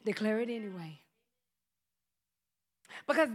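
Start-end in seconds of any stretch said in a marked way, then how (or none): 0.0-1.0 gentle voice of God. Retold or imitated. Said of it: "And God's like".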